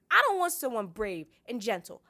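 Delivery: high, loud stubborn refusal; petulant girl protesting loudly; insistent. A narrator's reading. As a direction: throw the words back indignantly. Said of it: "Sansa insisted".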